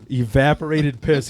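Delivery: ordinary talk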